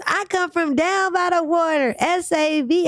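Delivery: put-on high, slow, squeaky kid rap. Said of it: "squeaky voice".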